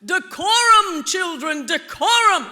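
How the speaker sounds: stretched-out, loud genteel scolding; slow, lofty Southern-lady reproach; prim principal's rebuke. Retold or imitated. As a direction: draw it out like a scandalized Southern principal. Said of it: "she loved words like".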